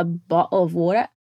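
put-on British accent, "bottle of water" with closed, dropped t's; imitated British accent. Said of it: "Só que ele fala".

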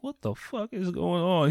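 high, slow baffled question; retold bewilderment. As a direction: act out a parent totally thrown. Parents react to son's freak-out.

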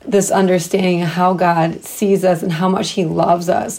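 ordinary delivery

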